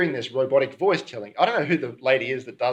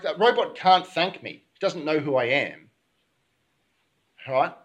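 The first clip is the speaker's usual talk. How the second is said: normal talking voice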